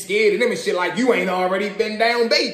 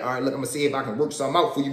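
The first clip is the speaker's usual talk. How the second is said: lightly voiced friend; 6 semitones lower, 5 dB quieter, much faster than usual. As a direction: lower and fast, a quick promise. Friend voiced lower, fast.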